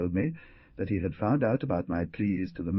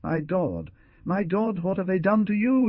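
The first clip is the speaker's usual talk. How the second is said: rising, louder despairing cry; anguished cry; 10 semitones higher, 3 dB louder than usual. Acted. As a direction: cry out in horror at his wounds. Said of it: "a cry escaped".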